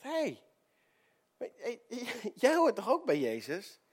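high, mocking call with a laugh; teasing servant girl. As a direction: point him out playfully. Servant girl accuses Peter.

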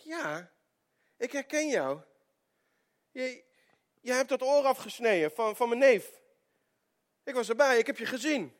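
high, loud, certain accusation; insistent accuser. Retold or imitated. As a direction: nail him with what you saw. Relative accuses Peter.

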